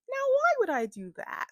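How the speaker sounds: high, mock-innocent sarcastic question; sarcastic innocence. Heroine mocking prisoners who blame her, sarcastic. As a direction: play dumb with heavy sarcasm. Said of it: "her sarcasm to all these people".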